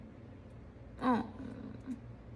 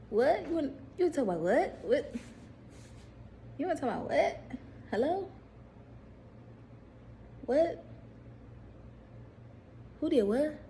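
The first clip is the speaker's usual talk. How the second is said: playful hammed-up disbelief on the phone; mock incredulous; 6 semitones higher than usual. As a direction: pretend you can't believe your ears.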